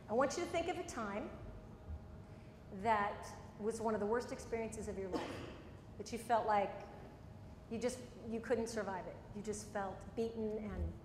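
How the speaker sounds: hushed therapist guiding a memory; guided visualization. Acted. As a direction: lead her gently into a bad memory.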